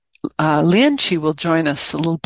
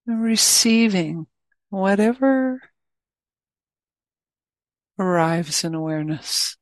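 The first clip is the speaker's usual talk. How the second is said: guided meditation. Acted. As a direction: guide listeners gently into awareness, leaving plenty of space.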